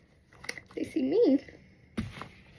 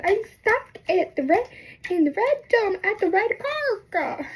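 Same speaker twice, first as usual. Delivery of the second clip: higher, slow emphatic repeat; exasperated repeat; 9 semitones higher than usual. Retold or imitated. Stuck girl repeating to mom, exasperated and high. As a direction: spell it out higher, dragging the last words.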